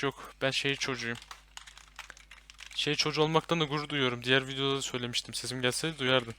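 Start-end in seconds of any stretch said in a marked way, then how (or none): none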